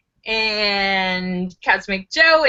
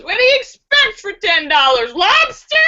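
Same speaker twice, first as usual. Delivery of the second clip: mocking hooker; 8 semitones higher, 4 dB louder than usual. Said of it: "The hooker laughs and says".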